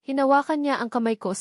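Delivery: ordinary delivery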